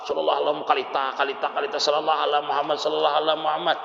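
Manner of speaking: fast, rattled-off salawat; mock rushed chanting over prayer beads; hurried recitation. Shown as a demonstration. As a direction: race through the repeated phrase. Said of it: "Jangan dia bacanya tuh sambil".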